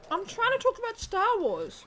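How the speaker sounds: high, loud frustrated whine; exasperated complaint. Real, not acted. Frustrated at interruptions.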